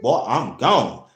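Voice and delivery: usual speaking voice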